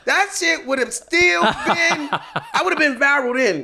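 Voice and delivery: high, loud laughter running through the words; laughing. Real, not acted. Telling the podcast host about the cop's clothesline, real laughter.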